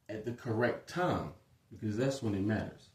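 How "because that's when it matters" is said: ordinary talk, nothing marked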